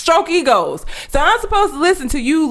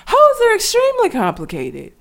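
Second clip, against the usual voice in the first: mocking read; 10 semitones higher than usual. Reading the article's claim mockingly.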